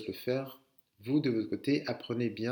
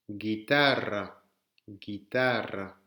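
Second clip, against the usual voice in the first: Guitarra said slowly, rolled rr; slow model; 3 semitones lower, much slower than usual. Shown as a demonstration. Teacher modelling Guitarra slowly for the class. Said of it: "Celui-ci".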